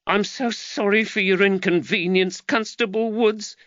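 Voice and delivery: mother apologises to the constable; apologetic mother. Acted. Giacchetta apologising.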